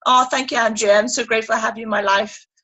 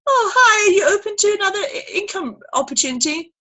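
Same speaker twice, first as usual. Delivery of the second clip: chirpy sales pitch; 8 semitones higher than usual. Retold or imitated. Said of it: "you're just gone".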